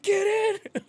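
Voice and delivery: very high, loud shout; shouted get in. Retold or imitated. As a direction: yell it from the car. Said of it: "he's like".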